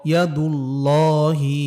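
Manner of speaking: slow, drawn-out chanted recitation of ইয়া দুল্লহি; Quranic recitation. Shown as a demonstration. Teacher chanting the example for students, slow and drawn out. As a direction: chant ইয়া দুল্লহি slowly, stretching each vowel. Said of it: "যেমন ইয়া জবর ইয়া".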